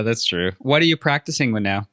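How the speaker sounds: ordinary talk, nothing marked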